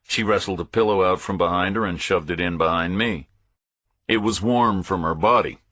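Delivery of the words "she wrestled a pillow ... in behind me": ordinary delivery, nothing marked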